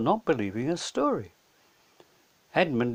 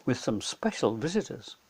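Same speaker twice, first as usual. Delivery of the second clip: ordinary delivery, nothing marked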